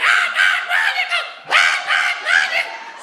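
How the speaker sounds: high, loud frantic parrot screech. Acted. Parrot squawking while he smacks the cage, screeching parrot. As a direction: shriek like a panicked bird.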